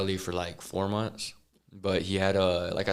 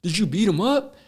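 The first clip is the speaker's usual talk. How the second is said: much higher, rising shocked question, louder at the end; shocked gasp; 11 semitones higher, 4 dB louder than usual. Retold or imitated. Inmate sees blood on walls, squeaky shocked question. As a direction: recoil at the blood in the cell. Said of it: "he's like".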